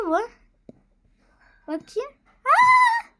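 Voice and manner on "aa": high loud gasp of delight; surprised squeal. Real, not acted. Squealing when the item scans.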